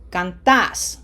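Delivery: canTAS, ending hit harder; stress on last syllable. Shown as a demonstration. Teacher shows the opposed take, cantas stressed on TAS. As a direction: keep "can" light and land on TAS in cantas. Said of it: "as opposed to".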